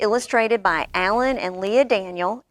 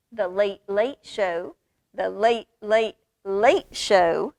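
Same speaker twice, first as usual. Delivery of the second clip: each late drawn out slower; slow building repetition; slower than usual. A narrator's reading. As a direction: stretch the lates longer each time.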